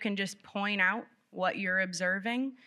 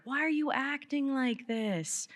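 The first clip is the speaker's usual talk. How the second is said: higher, slow, hammed-up scolding parent; exasperated parent; 6 semitones higher than usual. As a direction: play the fed-up parent, pitched up and drawn out. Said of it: "old phrases".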